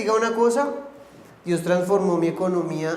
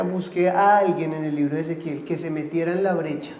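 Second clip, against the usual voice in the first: ordinary talk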